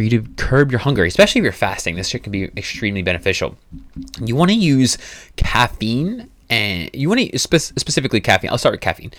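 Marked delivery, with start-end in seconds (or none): none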